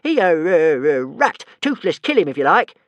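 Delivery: high, loud eager stammer; gleeful dragon. A narrator's reading. Toothless urging Hiccup to kill Alvin, gleeful. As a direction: perk up at the thought. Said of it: "cheering up no end".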